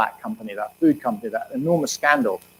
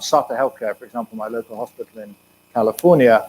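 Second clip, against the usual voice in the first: normal talking voice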